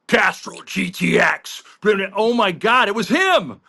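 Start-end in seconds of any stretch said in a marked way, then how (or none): 0.1-1.6 commercial voiceover. Retold or imitated. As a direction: roll out the brand name like the real spot. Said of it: "You sound like the guy from".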